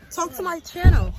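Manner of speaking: loud call. Real, not acted. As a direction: urge her loudly to talk. Calling out loudly.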